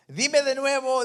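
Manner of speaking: más agudo y fuerte, incrédulo; gritando hacia arriba. Retold or imitated. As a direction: pide que repita a gritos. Jack shouting up. (Jack colgado le grita a Dios que repita)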